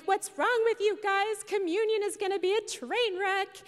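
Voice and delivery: mock-angry complaint at everyone else; retold anger. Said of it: "now I'm getting angry".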